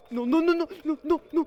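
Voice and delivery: high, loud frantic nos; shouting no, no, no in high alarm; panicked shouting. Real, not acted. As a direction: yell no over and over in panic. Panic in game.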